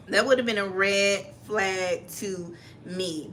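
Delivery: "red flag to me" spaced out slowly; slow, deliberate. Real, not acted. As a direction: draw out each word for emphasis, with pauses between. Repeats it after being talked over.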